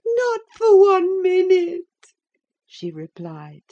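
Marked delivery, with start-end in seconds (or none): 0.1-1.9 high, loud, tearful cry; frantic, crying shout; desperate refusal. A narrator's reading. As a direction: wail the refusal at him.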